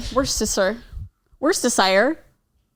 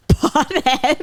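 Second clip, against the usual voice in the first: judgmental pothead; 7 semitones higher than usual. Retold or imitated. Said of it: "Matt sounded so judgmental".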